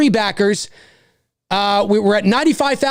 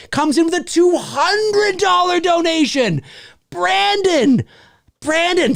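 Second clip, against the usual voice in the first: high, hyped donor call-out; excited announcing; 6 semitones higher than usual. Real, not acted. Host hailing a donor's big gift, excited announcing.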